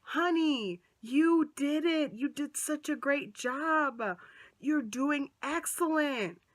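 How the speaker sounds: imitating a nurse's slow sweet praise. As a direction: coo it like a doting nurse. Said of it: "she's like".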